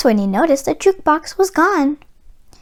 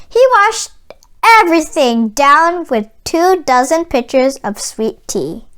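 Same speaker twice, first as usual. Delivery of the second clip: ordinary delivery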